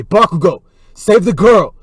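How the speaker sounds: loud, high-pitched cry for a rescue; urgent shout. Acted. Deku calling Bakugo to save a girl, urgent shout. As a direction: call out urgently across a battlefield.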